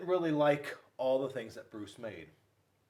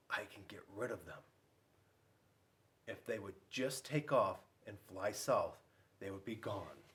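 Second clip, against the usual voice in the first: grumpy bear muttering; 4 semitones lower, 6 dB quieter than usual. Acted. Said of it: "he thought".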